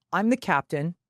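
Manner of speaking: ordinary delivery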